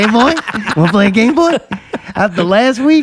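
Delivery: high, loud doggy talk. Pretend. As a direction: coax him like an excited pup. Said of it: "you gotta talk to Mayday like he's one of them".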